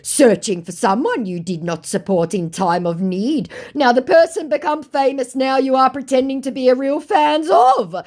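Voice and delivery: high, loud accusing put-on voice; mock scammer voice. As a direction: turn the accusation back on her. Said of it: "To which he said".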